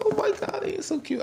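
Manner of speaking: high whispered squeal; falsetto whisper. Retold or imitated. Vlogger fawning over the couple, high whisper. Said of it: "I was like".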